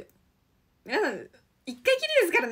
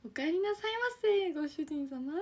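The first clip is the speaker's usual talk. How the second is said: high sugary welcome home master; maid cafe greeting; 4 semitones higher, 5 dB quieter than usual. Pretend. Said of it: "メイドさんって".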